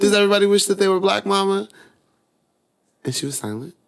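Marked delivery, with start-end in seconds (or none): 0.0-1.7 put-on much higher, louder, rushed child voice; curious child. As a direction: ask it fast like an eager little kid. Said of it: "And I was like".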